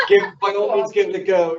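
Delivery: laughing while inviting it; chuckling, amused permission. Real, not acted. Amused reply to the Cockney joke.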